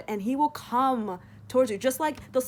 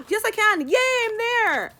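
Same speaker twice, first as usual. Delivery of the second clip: high, loud happy cheer; real delight; 12 semitones higher, 6 dB louder than usual. Real, not acted. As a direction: celebrate finding the page. Found the verse, delighted.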